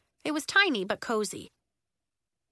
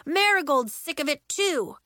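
fed-up raised voice; higher-pitched exasperated complaint; mild yell; 6 semitones higher, 4 dB louder than usual. A narrator's reading. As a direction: call it through a door. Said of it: "yelled Elliot through the door".

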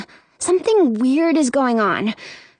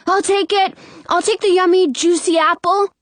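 put-on high, eager kid's voice; eager little boy; 9 semitones higher, 4 dB louder than usual. A narrator's reading. Brother running out for the apple, eager. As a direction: pipe up, pitched well up.

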